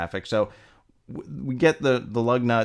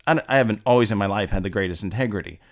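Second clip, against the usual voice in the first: normal talking voice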